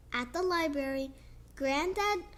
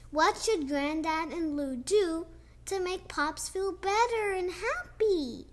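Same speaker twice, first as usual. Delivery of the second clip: ordinary delivery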